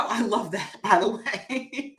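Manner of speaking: laughing through the words; amused by a viewer's name, laughing. Real, not acted. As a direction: giggle while you say it.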